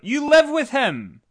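mock-indignant, stretch it out in disbelief; exasperated outburst. Acted incredulous reply to a commentator.

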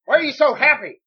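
hammed-up bewildered old wrestler; clueless old-timer. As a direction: play a man who just doesn't get it. Impersonates clueless old Bob Backlund.

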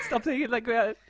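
laughing protest. Real, not acted. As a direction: blurt it out through laughter.

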